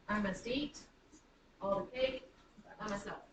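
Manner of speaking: "I must eat", "all the cake", "by myself" as three separate groups; foot by foot. Shown as a demonstration. Teacher counting three feet, chunked delivery. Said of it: "anapestic feet are in the sentence".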